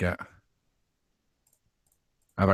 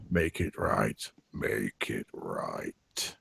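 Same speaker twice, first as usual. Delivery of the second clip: low, slow eerie murmur; a dead voice murmuring from the back seat; ghostly whisper; 11 semitones lower, 10 dB quieter, much slower than usual. Acted.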